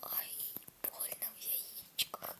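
breathy joking whisper of pain. Pretend. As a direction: whisper the ouch as a joke. Mock pain after fall.

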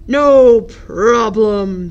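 slow, loud booming voice; booming elephant. Acted. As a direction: boom it out like a big elephant. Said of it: "boomed Elephant".

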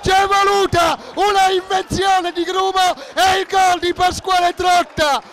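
breathless italian goal commentary. Real, not acted. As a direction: scream the goal call, then ride it down. Goal scored, commentator erupts.

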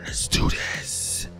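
slow, drawn-out whisper; hushed hype. Pretend. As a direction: whisper it slowly to build suspense. Psyching up before the video starts.

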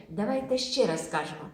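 normal talking voice

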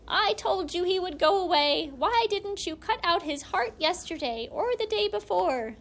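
high, loud hag's scolding. A narrator's reading. Long-toothed hag scolding the hag of the house. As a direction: blame her for letting him go.

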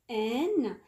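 usual speaking voice

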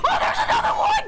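very high, fast, loud shriek; shocked high yell, rushed; alarmed scream. Real, not acted. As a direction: scream a sudden warning. Surprised by another enemy.

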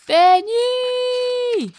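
long, very high, very loud scream of a name; furious yell. A narrator's reading. Médusa screams for the runaway girl, very high and loud. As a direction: scream the name across the swamp. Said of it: "une voix hurle".

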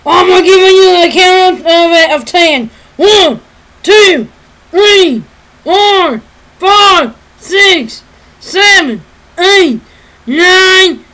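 loud, high cartoon outlaw countdown; Yosemite Sam. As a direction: bellow each count as a separate threat. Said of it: "I have two parts to this one".

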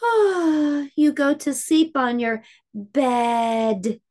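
usual speaking voice

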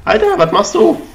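high, loud disbelief; yelling at the screen in disbelief; exasperated outburst. Real, not acted. Frustrated at failed jump.

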